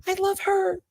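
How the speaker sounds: very high gushing squeal; gushing falsetto. Retold or imitated. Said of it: "I just was like".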